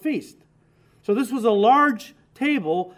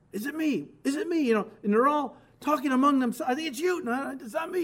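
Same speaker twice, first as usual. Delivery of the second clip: higher, overlapping worried voices of the disciples; anxious disciples; 5 semitones higher than usual. Acted. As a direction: play the startled disciples asking each other in alarm. Disciples asking anxiously at supper.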